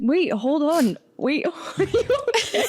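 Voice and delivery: high laughing question. Real, not acted. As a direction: ask while giggling. Laughing concern.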